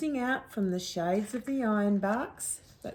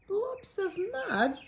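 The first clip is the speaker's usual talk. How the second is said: laughing dingo; 12 semitones higher than usual. Acted. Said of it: "Dingo laughed".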